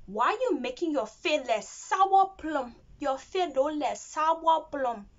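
slow, teasing Liberian English. Shown as a demonstration. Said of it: "Liberian say".

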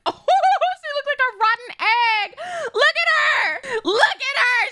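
very high, loud squeal; delighted shrieking. Real, not acted. Gamer mocking her character's looks, shrieking. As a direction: shriek it out, pitch way up.

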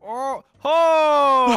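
loud high shocked cry; shocked shout. Real, not acted. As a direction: yell out in shock. Teammate going down.

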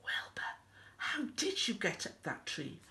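soft, unvoiced bedtime whisper; hushed secret question. A narrator's reading. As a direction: whisper it with a smile. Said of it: "Elmer smiled and whispered".

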